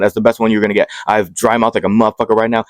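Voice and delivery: ordinary talk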